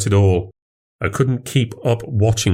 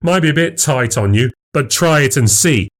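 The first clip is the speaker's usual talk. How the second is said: higher, mocking goad; taunting; 6 semitones higher than usual. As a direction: taunt him as you toss it over. Taunting rival with sports bra.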